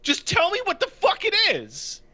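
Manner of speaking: high, loud, strained outburst; exasperated high yell. Retold or imitated. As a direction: scream it in frustration. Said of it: "And he's like".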